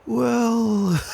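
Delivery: a light, very high amused chuckle. Acted. Soren laughing at Dash's teary scolding, light chuckle. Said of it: "Soren chuckled".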